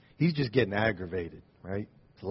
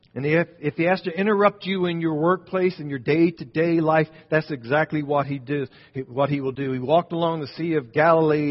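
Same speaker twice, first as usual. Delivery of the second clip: louder, higher, driving the story forward; preaching at full force; 4 semitones higher, 5 dB louder than usual. Acted. Preacher rousing the congregation, raised voice.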